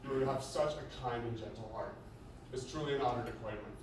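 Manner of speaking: usual speaking voice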